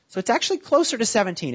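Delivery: usual speaking voice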